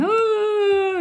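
high imitated crying wail; mock crying wail. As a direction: mimic her hysterical sob. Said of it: "she's hysterically crying".

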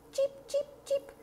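very high, soft chick peeps; tiny hungry chicks begging; baby bird cheeping. Acted. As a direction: hatchlings calling for food. Baby birds cry for food.

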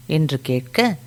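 usual speaking voice

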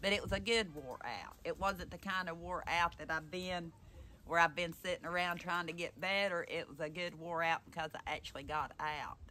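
ordinary delivery

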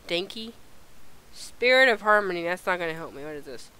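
ordinary delivery

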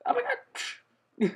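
very high, very slow stretched squeal; mock character squeal. Voicing the game character, a drawn-out high squeal. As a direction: ham up a shocked little man. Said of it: "He's like".

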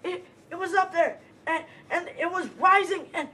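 high, breathless frightened stutter; pitched high, tripping over words; panicked stammer. Acted. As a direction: stammer in terror. Stammering after seeing beast.

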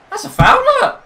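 very high, loud protest; outraged yell. Real, not acted. Player protesting a foul, outraged high yell. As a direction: screech at the unfair call.

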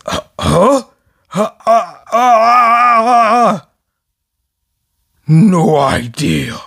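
slow, halting, confused stutter; dazed stammer. Acted. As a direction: stammer like his brain is mush. Voicing confused drooling Spinner.

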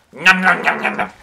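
silly munching sounds; mock eating noises. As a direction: chomp it like a cartoon. Pretending to eat French fry.

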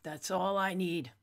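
ordinary talk

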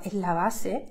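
ordinary talk, nothing marked

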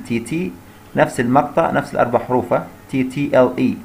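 ordinary delivery

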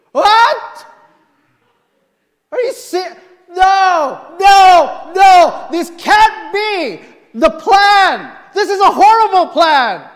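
high, loud, angry refusal; furious protest. Retold or imitated. Patient learning of a second surgery, loud angry protest. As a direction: shout no like it's unfair.

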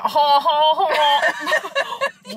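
loud, high imitated guffaw; hammed-up honking laughter; mock group cackle. Imitating her girl pals together, raucous mock laughing. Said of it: "when I sit in my girl pals, we're like".

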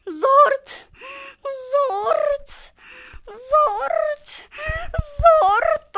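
high, loud squeaky exclamation; silly squeal. Pretend. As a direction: squeal it like a cartoon. (yüksek perdeden komik ciyaklama)

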